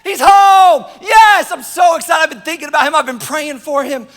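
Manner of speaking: high, loud shouts of joy, easing off; overjoyed brother. Acted. Brother hears his sibling is home, overjoyed shouting. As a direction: cheer loudly, then settle into gushing relief. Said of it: "To which the brother said".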